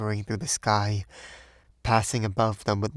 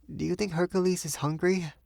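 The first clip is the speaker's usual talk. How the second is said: Sylvia, anxious; 9 semitones higher than usual. A narrator's reading. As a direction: ask it with a worried, lifted voice. Sylvia worried about the dog.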